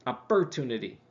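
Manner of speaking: ordinary talk, nothing marked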